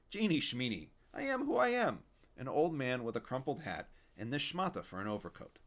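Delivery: loud, cranky Yiddish grandpa; Yiddish old man. Acted. Fischel voiced as Yiddish old man.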